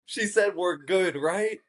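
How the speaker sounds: high, slowed questioning voice; hopeful girl. Retold or imitated. Girl checking with the speaker, high hopeful question. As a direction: ask high, seeking reassurance. Imitates the anxious girl seeking reassurance.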